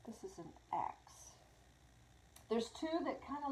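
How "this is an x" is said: whisper. Real, not acted. Aside while reading the rune.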